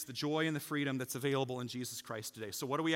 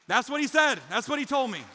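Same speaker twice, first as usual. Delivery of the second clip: loud, high, impassioned pulpit shout; full-force preaching; 8 semitones higher, 8 dB louder than usual. Real, not acted. Preacher urging the church to be a blessing, full-force preaching. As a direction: drive it out to the whole room, high and loud.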